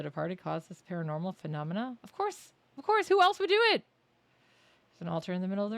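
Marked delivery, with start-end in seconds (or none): 2.0-2.5 sarcastic squeak. Real, not acted. Sarcastic high retort. 2.8-3.1 loud sarcastic squeal. Real, not acted. Louder sarcastic retort. 3.1-3.8 squeaky, loud, mocking; high sarcastic shout. Real, not acted.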